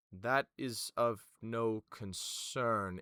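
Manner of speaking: slow, dismissive formal official; Vima. Acted. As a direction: brush it off with measured authority. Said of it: "You watch as Vima goes".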